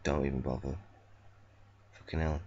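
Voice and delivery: usual speaking voice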